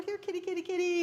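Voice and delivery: high sing-song here kitty; calling a cat. Retold or imitated. Minister searching for his lost cat, high calling. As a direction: coax the cat in a high call.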